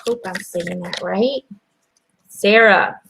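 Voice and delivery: ordinary delivery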